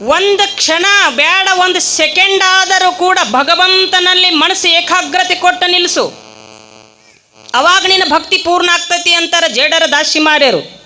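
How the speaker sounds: rising sermon fervour; forceful preaching. Acted. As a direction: preach it at full force to the crowd. Preacher's full-force exhortation.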